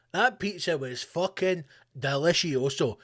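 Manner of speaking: normal talking voice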